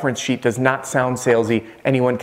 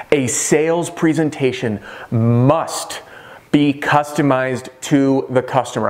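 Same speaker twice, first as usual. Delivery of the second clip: slow, weighty delivery; slow and deliberate; slower than usual. Shown as a demonstration. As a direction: lean on each word, slowly. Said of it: "I'm gonna repeat".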